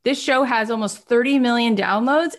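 ordinary delivery

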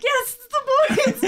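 excited. Retold or imitated. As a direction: celebrate it with a laugh. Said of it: "and I'm like".

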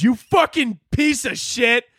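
high, drawn-out outrage; mock outrage, slowly spat insult; mad uncle. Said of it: "he got so mad".

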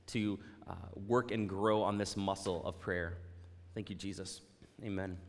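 soft, hushed closing prayer; quiet prayer. Acted. Pastor praying softly over his congregation before the sermon. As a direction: pray gently over the congregation.

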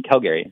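"Calgary" as "Cal-GARY"; "Calgary" ending like the name Gary; family's pronunciation. Shown as a demonstration. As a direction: say "Calgary" with a full middle vowel. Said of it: "that's just the correct pronunciation".

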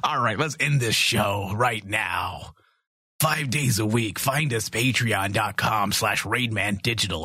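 low mock-macho sign-off; put-on manly announcer voice; deep voice. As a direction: play an overly masculine tough guy. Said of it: "I'm overly masculine".

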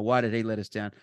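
usual speaking voice

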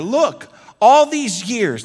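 slow, loud, high indignant complaint; resentful son confronting his father; angry older brother. Acted. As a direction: bite out the grievance slowly. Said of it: "became angry".

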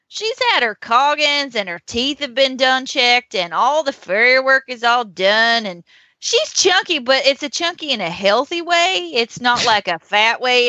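chatty Southern horse seller; Southern accent. Acted. Seller talks up a pony's health, Southern accent. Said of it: "it has to be Southern".

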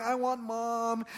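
imitated crying child. Hurt son asking for his mother, mock crying. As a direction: whimper it like a hurt little boy. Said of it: "like, just crying".

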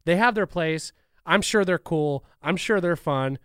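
ordinary talk

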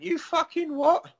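high, drawn-out incredulous question; retold indignant double-take; outraged disbelief. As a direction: stretch it out high, scandalised. Retold reaction to an accusation.

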